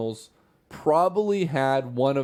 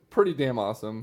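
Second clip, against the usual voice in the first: ordinary delivery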